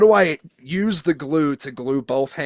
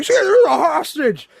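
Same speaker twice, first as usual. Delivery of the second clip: higher, louder mock panic; mock alarm; 7 dB louder than usual. Bystander noticing the hostage.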